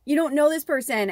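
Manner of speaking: insisting after the tears break; firmer repeat. Real, not acted. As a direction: say it again and mean it. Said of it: "starting to get emotional about it".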